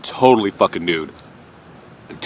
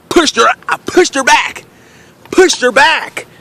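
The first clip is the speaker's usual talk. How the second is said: frantic retelling; 12 semitones higher than usual. Retold or imitated. Retold panic pushing her off.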